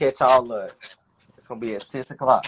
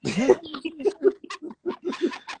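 high laugh; 4 dB quieter than usual. Real, not acted. Laughing at guessing.

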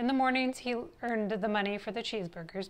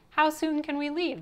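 higher, louder delighted question; eager cry; 6 semitones higher, 5 dB louder than usual. A narrator's reading. Parents learn of their Hawaii cruise, crying out eagerly. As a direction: ask it eagerly, pitched up. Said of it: "they cried".

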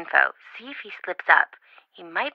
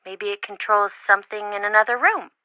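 normal talking voice

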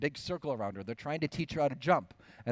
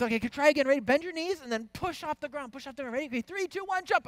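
put-on much higher, eager kids' countdown; high excited coaching; 4 dB louder, faster than usual. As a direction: coax the baby like eager siblings. Siblings coaching baby again.

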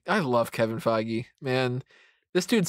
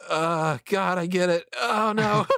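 rising, higher mock alarm at getting choked up; a half-joking, much higher oh no; mock dismay; 7 semitones higher than usual. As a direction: play being caught off guard by your own tears. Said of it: "And it's like".

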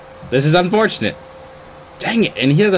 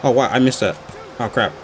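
ordinary delivery

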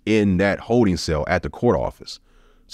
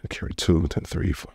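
muttered counting; 4 dB quieter than usual. Retold or imitated. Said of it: "trying to add up".

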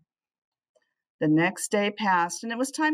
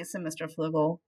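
ordinary delivery

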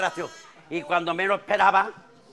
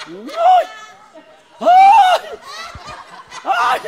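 very high, loud shrieking cries; wife's shrieks; 9 dB louder, much slower than usual. Acted. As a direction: shriek in shock, over and over. Wife screams in story. (chillidos agudos de la mujer)

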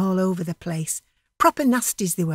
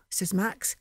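plain reading voice

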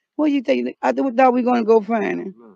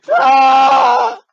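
sudden scream; 3 semitones higher, 6 dB louder than usual. Acted.

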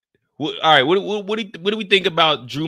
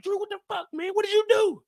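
high mock outrage, much higher; fake shock. [name] faking outrage at Drew over the bodies. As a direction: ham up the shocked accusation. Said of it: "It's like".

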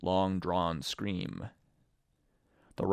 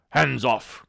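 louder, higher warning cry; sharp cry; 5 semitones higher, 8 dB louder than usual. Acted. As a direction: bark it out in alarm. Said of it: "cried Silver".

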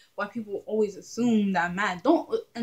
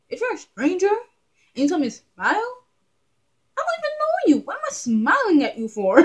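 high, loud indignant rant at a stranger; mock outrage; 9 semitones higher, 6 dB louder than usual. To a stranger who told her to smile, high outraged squeal.

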